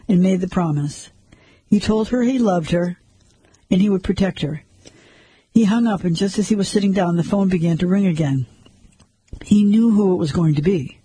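plain reading voice

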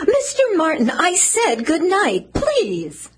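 high, drawn-out exasperated plea; nervous insistence. Acted. Woman insisting unwanted man leave, nervous and insistent. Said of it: "what are you so nervous about".

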